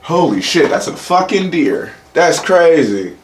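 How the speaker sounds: high-pitched astonished outburst, the last words slowed; genuinely stunned, high and drawn out; real amazement. Real, not acted. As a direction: gasp at a deer appearing out of nowhere. Suddenly sees a deer.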